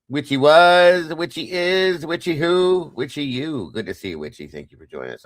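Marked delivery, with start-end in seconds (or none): 0.1-3.7 high, loud playful chant of the name; goofy singsong. Real, not acted. Host greeting a viewer named Witchy in a goofy high singsong. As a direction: sing the name out like a little rhyme. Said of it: "I don't know why I'm talking like this today".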